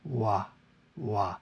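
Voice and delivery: wah with the tongue just reaching up, no contact; correct W sound. Shown as a demonstration. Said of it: "We wanna make a".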